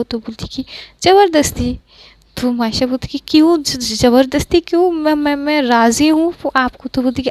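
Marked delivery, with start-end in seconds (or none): 1.0-1.8 ज़बरदस्ती pitched high, then falling; forceful one-word answer. Acted. Pankhuri answers Maisha's worried question, ज़बरदस्ती said high and emphatic. 3.3-6.9 higher-pitched, stumbling protest; alarmed stammering. Acted. Maisha protests to Pankhuri that no force is needed, alarmed stammer.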